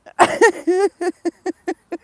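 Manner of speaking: very loud, high howl of laughter. Real, not acted. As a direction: throw your head back and howl. Laughing fit.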